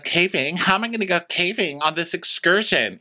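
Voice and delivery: high, mildly panicked imitation; mock terrified guest. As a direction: play the scared guest, a touch shrill in pitch.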